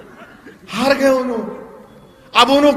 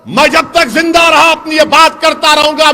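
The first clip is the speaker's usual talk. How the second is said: ordinary delivery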